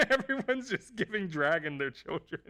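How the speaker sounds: high, cracking up while talking; laughing. Real, not acted. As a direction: blurt it through helpless laughter. Laughing, mocks parents' pleas in story.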